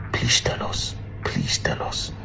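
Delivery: breathy whispered please tell us. Real, not acted. Begging the police for the truth, in a whisper. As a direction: whisper the plea as it fades out. Closing plea, fading to whisper.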